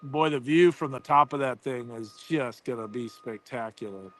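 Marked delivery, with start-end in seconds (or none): none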